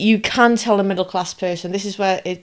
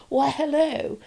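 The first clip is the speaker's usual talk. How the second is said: a lightly put-on upper-class hello, pitched higher; mock the upper class with a higher hello; posh greeting; 8 semitones higher, slower than usual. Host mimics an upper-class hello, pitched higher.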